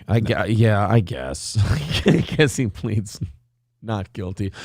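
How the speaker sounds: low, resigned mumble, trailing off; a beaten lawyer conceding to the judge; defeated mumble. Retold or imitated. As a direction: give up on the plea quietly.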